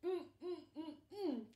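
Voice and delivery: high, muffled cries through a gagged mouth; muffled cucumber. Acted. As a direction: hum desperately as if gagged. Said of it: "cried Cucumber".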